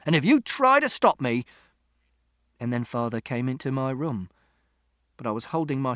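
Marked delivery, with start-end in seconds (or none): 0.0-1.4 loud, climbing in pitch; angry threat. A narrator's reading. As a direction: warn them off, still heated. Father threatens during shouting match.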